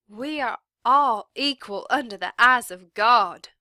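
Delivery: loud, proud declaration; teacher defying the sheriff; declared. A narrator's reading. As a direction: stand firm and proclaim it. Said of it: "she declared".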